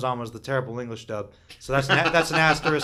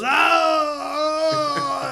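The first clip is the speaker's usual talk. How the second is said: high, loud, drawn-out "aah" scream; hammed-up death scream, held long and high; long imitated scream; 13 semitones higher, 7 dB louder than usual. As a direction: wail it out like a sinking movie victim. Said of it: "turns to the camera and goes".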